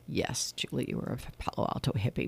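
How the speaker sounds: whispered mock answer in someone else's voice; mock host reply. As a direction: whisper the host's line for him.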